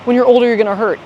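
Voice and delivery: mocking a nagging critic. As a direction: parody a worried critic. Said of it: "Little men have a little bit of fun".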